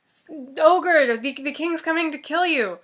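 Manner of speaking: calling out loud through a door; urgent call. Acted. Fox shouting a warning at the ogre's door, urgent.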